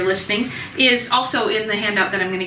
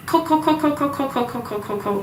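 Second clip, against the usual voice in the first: hen clucking; 3 semitones higher than usual. Shown as a demonstration. Teacher imitating the clucking hens from the song. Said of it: "This is the clucking of the hens".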